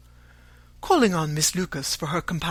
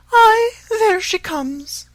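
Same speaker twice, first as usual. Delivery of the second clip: much higher, louder and slow, a fussy complaining mother; fretful mother; 11 dB louder, much slower than usual. A narrator's reading. Mother spotting her daughter, much higher fretful complaint. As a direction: cry it out high and aggrieved as the put-upon mother.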